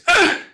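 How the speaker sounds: short high loud shriek of disgust; piercing groan of horror; horrified ugh. A narrator's reading. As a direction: recoil with a sharp cry. Recoils from the sight.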